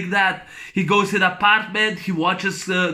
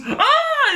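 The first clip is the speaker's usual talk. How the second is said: shrill playful shriek; mock scream; 4 dB louder than usual. Landlady startled by the masked tenant, shrieking high. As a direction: squeal it high and short. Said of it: "And Missus Pittman goes".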